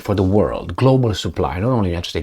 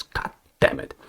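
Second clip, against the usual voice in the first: whispered, much softer swear to himself; muttered curse; 7 dB quieter than usual. Real, not acted. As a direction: hiss it under your breath in frustration. Frustrated aside mid-rant.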